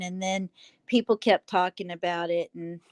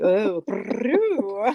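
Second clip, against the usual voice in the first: cooing pigeon voice; 2 semitones higher, 7 dB louder than usual. Retold or imitated. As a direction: coo it like a pigeon. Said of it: "he's like".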